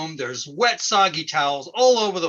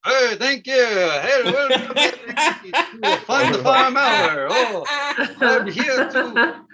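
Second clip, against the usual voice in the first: higher, hammed-up country host voice; cheerful mock farmer emcee; folksy farm-show host; 8 semitones higher than usual. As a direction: play a jolly hayseed comedian with corny chuckles. Fun Farm Hour host character.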